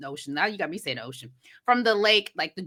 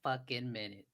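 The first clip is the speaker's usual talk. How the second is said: low disbelief; 11 semitones lower, 16 dB quieter than usual. Real, not acted. Stopping a friend who got a name wrong, low and dry. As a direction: hold it right there, drily. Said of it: "catching the wrong name".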